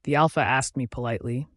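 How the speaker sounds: plain reading voice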